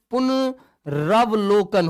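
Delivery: पुनरावलोकन spelled out slowly, each syllable clear; slow down and lay out पुनरावलोकन for the class; slow careful spelling demo. Shown as a demonstration. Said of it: "याद रखना इस वर्तनी को भी". (धीमे-धीमे साफ़ बोलकर)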